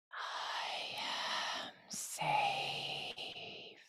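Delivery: very slow, drawn-out whisper on the breath; breathed, unvoiced whisper. Shown as a demonstration. Teacher showing words carried in the breath, slow whisper. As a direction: breathe the words out, stretching each one. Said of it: "didn't speak it and I just breathed it".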